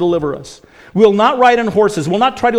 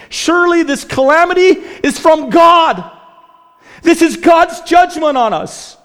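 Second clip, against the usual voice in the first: anguished outcry; 10 semitones higher, 5 dB louder than usual. Acted. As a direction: cry it out like a king who finally gives in. Preacher voicing Joram's admission.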